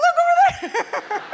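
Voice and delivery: high, slow, loud pointing out; mock alarm. Wife pointing out the wall in mock shock. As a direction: feign alarm and drag it out. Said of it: "I'm like".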